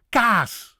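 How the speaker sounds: loud, high kaas with open aa; energetic Dutch pronunciation. Shown as a demonstration. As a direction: punch out kaas with a long aa. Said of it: "energy you have to put into".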